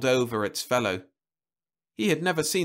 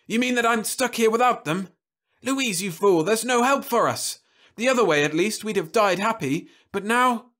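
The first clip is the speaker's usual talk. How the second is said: despairing outburst; 11 semitones higher, 5 dB louder than usual. Acted. Husband despairs, berates wife.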